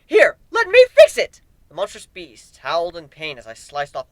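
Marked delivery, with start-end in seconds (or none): 0.0-1.4 very high, loud, gleeful chirp; crazed glee before slicing trunks; manic cheerful offer. Acted. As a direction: chirp it like a helpful child.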